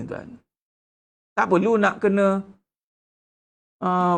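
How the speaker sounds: ordinary talk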